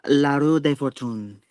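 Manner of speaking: La Roue de Fortune in a clumsy, Spanish-sounding French; butchered French. Shown as a demonstration. Tarot reader reading the French card name to Janelle, mangled French. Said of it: "completely butcher it".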